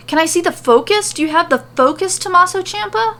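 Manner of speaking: hammed-up excitable pawnbroker voice; eager quirky shopkeeper. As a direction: rush it out, unable to wait. Eager character pesters.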